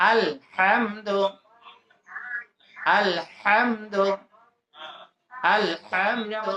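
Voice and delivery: "আলহামদু" very slowly, with a breathy throat h; throat ha drill. Shown as a demonstration. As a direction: split "আলহামদু" after আল, then draw out হাম from mid-throat. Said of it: "হা আছে না?". (টেনে টেনে, গলার মাঝখান থেকে হা দিয়ে "আলহামদু")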